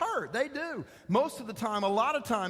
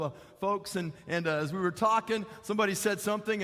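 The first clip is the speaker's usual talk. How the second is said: ordinary talk, nothing marked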